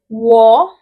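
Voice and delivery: a loud, clear "Wo"; modelling wo. Shown as a demonstration. Teacher modelling a new word, loud. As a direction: call out "Wo" for the class to copy. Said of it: "Wo means I".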